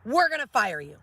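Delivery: high, pushy boss voice; quoting a boss. Retold or imitated. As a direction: rattle off the demands. Boss ultimatum to doctors, quoted.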